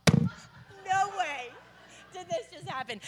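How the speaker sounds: high, then very soft and breathless; stunned disbelief. Retold or imitated. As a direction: let the words fall away in awe. Stunned awe after miracle.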